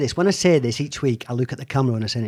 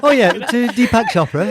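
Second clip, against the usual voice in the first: higher, louder, laughing through it; 8 semitones higher, 6 dB louder than usual. Real, not acted. Host joining the joke about Deepak Chopra, laughing. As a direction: laugh the words out.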